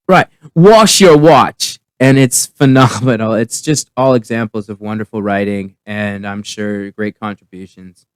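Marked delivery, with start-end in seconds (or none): 0.1-1.8 slow, higher, deliberate "wash your watch"; muddled actor. Retold or imitated. Actress muddles the director's correction, slow stagey delivery. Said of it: "then she goes".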